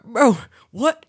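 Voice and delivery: high, loud disbelieving "what"; shocked outburst. Retold or imitated. Told a strange game rule, loud shocked disbelief. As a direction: blurt it out, totally thrown.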